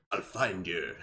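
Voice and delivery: low, soft, menacing movie threat; Liam Neeson threat. Retold or imitated. Quoting Liam Neeson in Taken, low menace. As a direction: drop it low and quiet, deadly. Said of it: "He also says".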